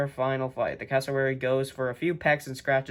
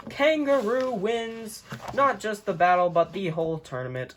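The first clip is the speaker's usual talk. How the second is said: higher triumphant announcement; excited winner call; 6 semitones higher than usual. Real, not acted. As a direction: crown the champion.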